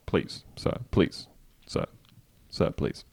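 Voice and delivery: hushed begging; whispered pleading. Pretend. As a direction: beg in a whisper.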